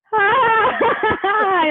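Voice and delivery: very high, drawn-out squeal; friends' mock squeal. Friends covering for her frozen friend, high mock squeal. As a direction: imitate friends squealing as they push.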